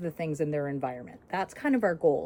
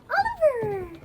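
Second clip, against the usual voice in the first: high bright name call; calling the puppy's name; 5 dB louder than usual. Real, not acted. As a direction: sing out the dog's name.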